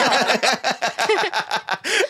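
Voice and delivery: high, hammed-up laugh; a big put-on laugh; fake laugh. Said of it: "Was that a fake laugh?".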